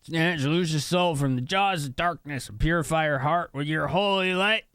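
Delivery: high put-on pleading voice; Ronfar's prayer. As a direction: intone it as a game hero's plea to a goddess. Reads game character's prayer.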